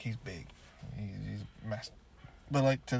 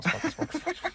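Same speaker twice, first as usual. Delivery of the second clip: sing-song sparkle. Pretend. Mocking the sparkling lights.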